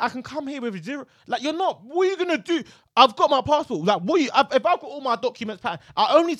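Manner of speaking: loud, high, fired-up rant; heated rant. Real, not acted. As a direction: hammer home your point, outraged. Heated rant about airport.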